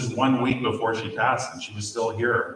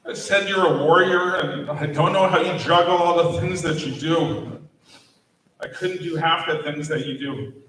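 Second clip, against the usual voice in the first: choked up, straining higher, long pauses between lines; fighting back tears as he remembers; voice breaking with emotion; 7 semitones higher than usual. Real, not acted. Eulogy speaker recalls telling dying colleague he's in awe, voice breaking.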